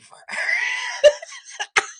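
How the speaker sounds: loud shrieking laugh. Real, not acted. Outraged laugh.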